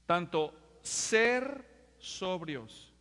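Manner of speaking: very slow, stretched; slow emphasis. Shown as a demonstration. Preacher points out the word, very slow. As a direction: spell out "sobrios" slowly. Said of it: "Quiero que vean algo".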